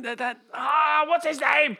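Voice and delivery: high-pitched searching for a name; high, straining to recall. Real, not acted. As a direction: voice rising while trying to remember.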